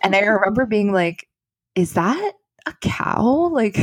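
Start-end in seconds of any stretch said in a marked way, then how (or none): 1.7-3.5 high, slow, puzzled question; half-awake disbelief at a moo; puzzled surprise. Retold or imitated. Waking up to a cow mooing.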